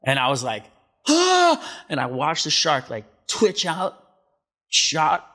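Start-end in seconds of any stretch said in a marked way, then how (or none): 1.1-1.8 high, loud shocked yell; startled scream. Retold or imitated. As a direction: let out a sudden cry of shock. Shark shot next to him.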